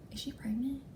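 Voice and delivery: hushed secretive whisper; whispered question. Retold or imitated. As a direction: whisper it like gossip. Girl asking secretly.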